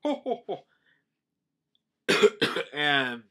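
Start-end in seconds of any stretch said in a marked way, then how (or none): 0.0-0.6 high-pitched put-on ho-ho-ho; acted storybook laugh; mock hearty laugh. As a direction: fake a jolly king's chuckle. Voicing Neptune's hearty laugh.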